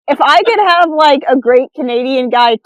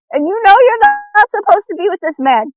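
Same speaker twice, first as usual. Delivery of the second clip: higher knowing psychic tone; 7 semitones higher than usual. Retold or imitated. Mimicking a TV psychic to the caller. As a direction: probe like a TV psychic.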